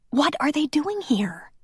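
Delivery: ordinary delivery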